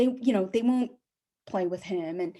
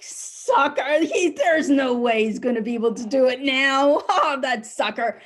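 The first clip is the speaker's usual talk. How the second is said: louder gloating jeer with a laugh; mocking rivals; 4 semitones higher, 6 dB louder than usual. Rival landscapers jeering at him, lightly voiced mocking laugh. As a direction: sneer at the poor guy and chuckle.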